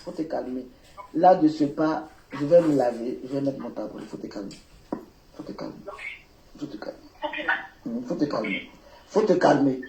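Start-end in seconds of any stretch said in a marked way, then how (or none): 0.0-0.7 soft, hushed calming refrain; real soothing. Real, not acted. Telling an upset caller to calm down, hushed and soothing. 3.2-8.7 very soft, low, almost under the breath; calm them down in a low hush; real soothing. Real, not acted. Telling an upset caller to calm down, very softly.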